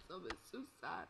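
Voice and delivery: retold crying. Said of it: "parts of the movie that made me cry".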